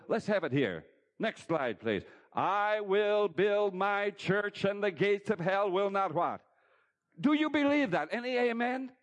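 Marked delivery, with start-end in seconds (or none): 2.3-6.4 very slow, loud, higher proclamation. Acted. Preacher proclaiming Jesus' promise to church, slow and loud. 7.2-8.9 high, loud call to the congregation. Real, not acted. Preacher calling congregation for amen, loud and high. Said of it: "calling to congregation".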